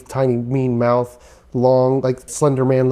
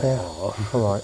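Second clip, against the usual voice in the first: softer, slow, deflated sigh of a reply; a touch of dejection; 2 semitones lower, 6 dB quieter, slower than usual. Acted. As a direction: let the hope drain out of it. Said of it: "you see Body kinda get depressed".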